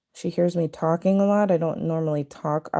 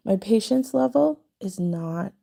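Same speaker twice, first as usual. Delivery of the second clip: ordinary delivery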